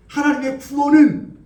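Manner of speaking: slow, high and loud; forceful proclamation. Acted. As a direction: proclaim God's salvation. David's proclamation climax. (강하게 선포하듯)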